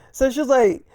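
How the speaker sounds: ordinary delivery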